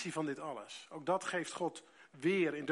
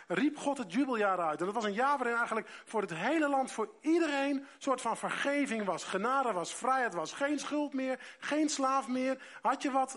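raised, ringing proclamation of the jubilee; fervent preaching; 5 semitones higher, 5 dB louder than usual. Acted. Preacher proclaiming the jubilee year to his church, ringing and raised. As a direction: preach it out high and loud to the congregation.

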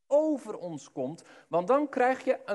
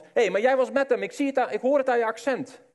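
louder, higher and fast; eager accusation; 5 semitones higher, 5 dB louder, faster than usual. Acted. Bystander recognising Petrus by his accent, loud eager accusation. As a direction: point him out as you catch him.

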